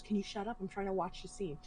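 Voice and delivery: hushed irritation. Retold or imitated. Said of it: "She goes".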